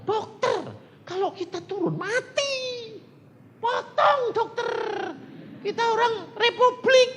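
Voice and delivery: high-pitched, frantic warning; alarmed boatman. Retold or imitated. Boatman warns the doctor they will die ashore, high alarmed cry. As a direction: imitate a panicked crewman crying out.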